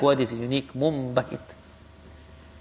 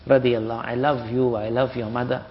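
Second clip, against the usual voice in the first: soft, tender, lower and quieter; tender devotion; 5 semitones lower, 7 dB quieter than usual. Shown as a demonstration. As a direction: say it like a whispered confession of love. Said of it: "naturally you will say".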